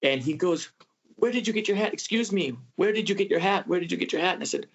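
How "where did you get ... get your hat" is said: high, eager retold call; calling after them, much higher and eager; excited calling out. Stranger yelling, running down alley.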